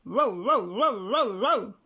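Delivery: loud rapid barks; barking dog. A narrator's reading. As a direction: bark over and over. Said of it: "said Bottomly Pots".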